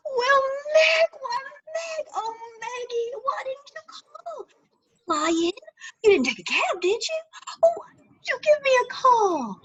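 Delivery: high, teary cry of surprise; tearful excitement. Acted. Lenny greeting her sister Meg, high and teary. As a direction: gush at her, near tears. Lenny emotional at Meg's arrival.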